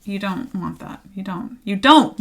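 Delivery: normal talking voice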